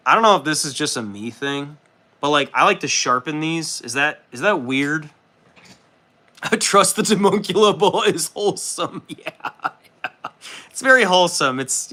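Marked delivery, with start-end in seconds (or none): 5.8-11.6 higher and louder, laughing through the words. Real, not acted. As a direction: read chat's joke while cracking up. Reading a chat joke, laughing.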